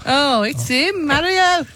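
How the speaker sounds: slow, high-pitched mock Italian accent; Mario. Co-host spotting a Super Mario t-shirt, playful Italian accent. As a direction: draw it out like a cartoon Italian. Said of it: "I've got my Super Mario t-shirt on".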